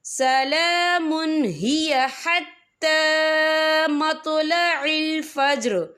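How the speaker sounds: slow, drawn-out tajweed chant; Quran recitation. Shown as a demonstration. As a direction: recite it slowly and melodiously. (धीमी तिलावत)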